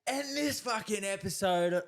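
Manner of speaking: high, stretched hype-host yell; over-the-top energy. Shown as a demonstration. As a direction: demonstrate an over-the-top intro. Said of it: "I want you to go".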